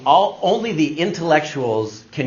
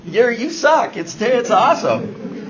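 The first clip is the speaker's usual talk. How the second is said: very high, drawn-out mock delight; mocking amusement; 8 semitones higher, slower than usual. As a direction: imitate coworkers gleefully making fun. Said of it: "they couldn't stop laughing at".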